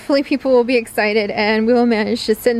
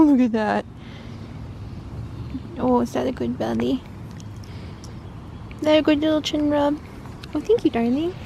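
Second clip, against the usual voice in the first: pet talk; 3 semitones higher than usual. Real, not acted. To Ozzy the purring cat, sweet cooing baby talk.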